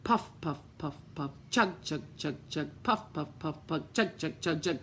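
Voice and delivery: low, rhythmic puff and chug; puffing train sounds. A narrator's reading. Little engine puffing up the mountain, low rhythmic chugging. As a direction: chug like a straining steam engine. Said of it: "went the little blue engine".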